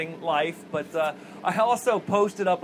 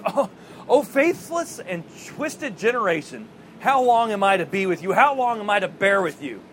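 exasperated rebuke; 4 dB louder than usual. Acted. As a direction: act out a weary, forceful reproach. Voices Jesus's exasperated rebuke.

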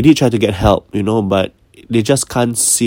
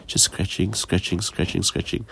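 fading whisper; 2 semitones lower, 10 dB quieter than usual. Retold or imitated. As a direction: trail off into a hushed, breathy whisper. Creepy sleepwalking scene retold.

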